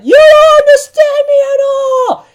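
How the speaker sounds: high, loud hammed-up outburst; squealed mock-tantrum shout; outraged mock yell. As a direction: cry it out in exaggerated, high-pitched indignation. Angry retort to a calm remark.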